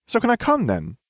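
high, eager and bright. Acted. Ginny cheerfully begs to join the rescue, bright and high. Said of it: "she asked brightly".

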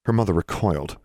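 usual speaking voice

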